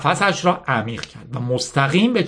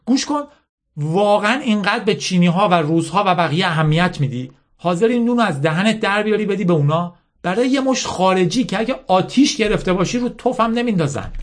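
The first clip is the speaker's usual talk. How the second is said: higher-pitched insistent lecture, lightly acted; bitter General Mala; 6 semitones higher than usual. Mala lecturing Yasmin on winners and losers, mildly bitter insistence. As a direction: drive the hard lesson home.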